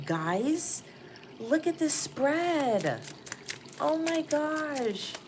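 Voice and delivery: high, stretched-out amazed exclamations; excited. Real, not acted. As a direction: gush in disbelief, drawing the words out. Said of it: "'cause I'm so excited".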